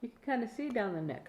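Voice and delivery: usual speaking voice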